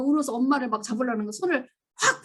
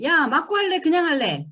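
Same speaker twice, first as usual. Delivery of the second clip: higher, louder threatening parent; mock threat; 6 semitones higher, 4 dB louder than usual. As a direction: bark an ultimatum at the kid. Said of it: "무슨 말을 많이 했어요?". (아이에게 최후통첩하는 부모, 흉내 낸 으름장)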